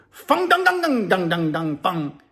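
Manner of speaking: slow ringing nonsense-language imitation; mock foreign gibberish. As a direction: draw out pretend words in a put-on voice. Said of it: "and the dude goes".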